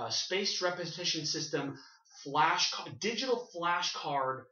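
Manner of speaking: ordinary talk, nothing marked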